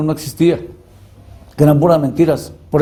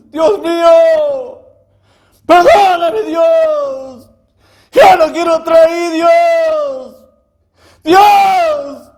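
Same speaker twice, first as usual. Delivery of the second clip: full-voiced wail, very high and sustained; screaming to God; 13 semitones higher, 4 dB louder, much slower than usual. Man on his knees begging God's forgiveness, screaming. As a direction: cry upward, stretching each word into a howl. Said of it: "grité hacia arriba y le dije".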